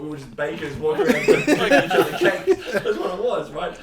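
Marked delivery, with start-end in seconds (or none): 0.0-2.5 laughing and louder, higher; giggling through the words. Real, not acted. Joking about cake, laughing.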